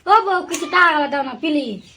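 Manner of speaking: soft, drawn-out wheedle; slow, hushed coaxing plea; pleading husband. Acted. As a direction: beg quietly and slowly, sinking at the end. Husband begging wife again.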